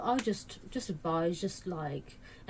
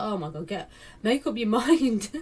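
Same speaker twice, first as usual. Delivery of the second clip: exasperated laugh; 4 semitones higher, 6 dB louder than usual. Retold or imitated. Exasperated reply to critics.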